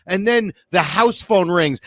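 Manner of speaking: usual speaking voice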